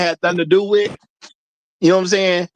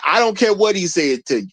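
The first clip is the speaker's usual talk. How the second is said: usual speaking voice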